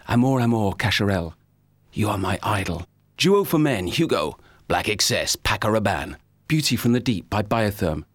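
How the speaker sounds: low, intimate luxury commercial voice; perfume ad read. Acted. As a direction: sell the fragrance, low and seductive.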